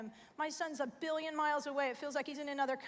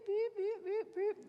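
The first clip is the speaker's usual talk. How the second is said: phone beeps; 7 semitones higher, slower than usual. Retold or imitated. Imitating phone notifications.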